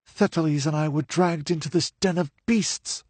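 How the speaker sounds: put-on high young woman's voice, rushing at first; hurrying through her story before she is missed; hurried telling. A narrator's reading. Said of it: "she continued hurriedly".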